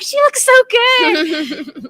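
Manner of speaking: high, slow, gushing admiration. Retold or imitated. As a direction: swoon over the look, drawn out. Gushing retold quote about cosplay.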